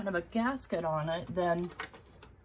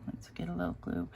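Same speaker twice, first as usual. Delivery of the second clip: low whispered note to self; whispered aside; 13 semitones lower, 9 dB quieter than usual. Real, not acted. Crafter reaching for glue, whispering to herself. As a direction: murmur it just for yourself. Talking to herself while gluing.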